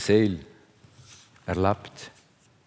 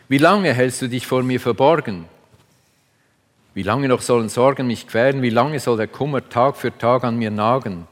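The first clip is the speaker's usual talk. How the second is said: louder, urgent lament to God; pleading psalm reading; 5 dB louder than usual. Acted. Preacher voices David's lament to God, louder and urgent.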